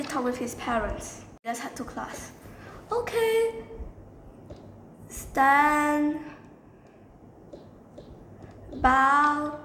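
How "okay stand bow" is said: raised, ringing classroom call; calling out commands. Acted. Student calling the class to stand and bow, calling out.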